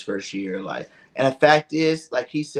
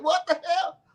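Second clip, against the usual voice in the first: high mock outburst; mock angry yell. Imitating the cussing country boy, a high mock angry outburst. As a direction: play the furious player blowing up.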